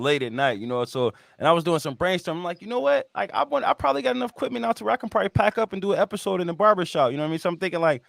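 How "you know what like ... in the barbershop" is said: lifted, eager voice for a sudden idea; excited inner thought. Retold or imitated. Host replaying his own late-night brainstorm, eagerly excited.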